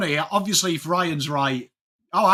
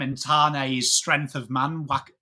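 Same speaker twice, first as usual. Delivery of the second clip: ordinary talk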